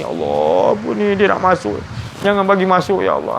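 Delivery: a mock tearful plea to God to keep temptation out; tearful prayer. The teacher voices a student pleading to God, mildly tearful. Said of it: "kau menangis".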